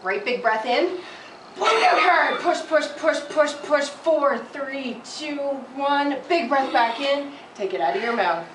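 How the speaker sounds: loud, urgent push-push cheering; coaching shout. Real, not acted. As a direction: drive the patient on, loud and high. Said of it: "I'm gonna count down and really push you hard".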